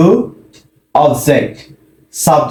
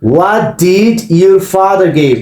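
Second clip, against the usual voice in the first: slow model question; 2 semitones higher, much slower than usual. Shown as a demonstration. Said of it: "so আমি question করতে পারি".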